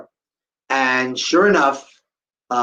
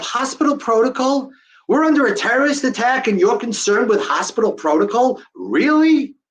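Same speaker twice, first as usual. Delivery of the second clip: high-pitched disbelieving retort; incredulous; 7 semitones higher than usual. Retold or imitated. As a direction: question it in rising disbelief, pitched up. Incredulous thought at hospital rule.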